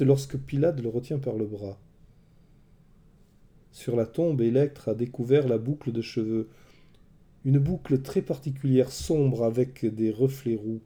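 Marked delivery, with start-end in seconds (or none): none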